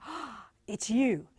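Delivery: slow, gasped mock delight; gasp, then coo it slowly; joking adoration. Joking with family once a week, gasping delight.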